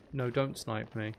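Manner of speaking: usual speaking voice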